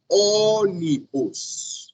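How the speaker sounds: "ônibus" very slow, high long ô, falling away; first syllable stressed. Shown as a demonstration. Teacher shows tonic and unstressed syllables, long stressed ô in "ônibus". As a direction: stretch the ô of "ônibus", let the rest drop. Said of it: "Primeira sílaba tônica e o resto átona".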